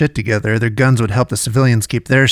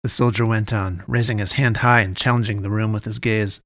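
ordinary delivery

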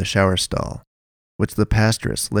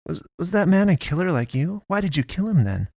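frightened Naomi; 9 semitones higher than usual. A narrator's reading. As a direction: ask in trembling disbelief.

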